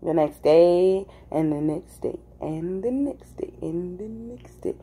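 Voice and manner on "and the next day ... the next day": fading into a slow near-whisper; hushed, drawn-out listing of passing days; trailing repetition. Real, not acted. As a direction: let each repeat drop softer and stretch out. Repeats to show days piling up.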